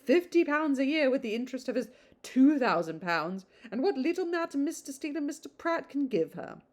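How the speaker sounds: gossiping matron, mildly put on; gossipy old lady. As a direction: rattle on like a busybody matron. Mrs Jennings voiced gossiping.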